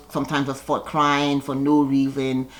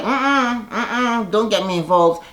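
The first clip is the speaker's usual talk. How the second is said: sassy refusal; 4 semitones higher, slower than usual. Retold or imitated. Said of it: "Giselle's like".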